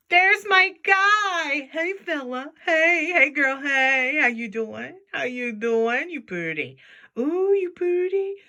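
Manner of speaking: put-on high, slow cooing baby talk; cooing at a pet. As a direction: coo at it like at a pet bird. Cooing at a peacock item.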